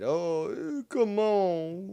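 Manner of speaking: high, whiny put-on protest; mock a huffy objection; imitating offended Travis. Streamer mimicking Travis taking offence, high and whiny. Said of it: "now Travis is getting offended".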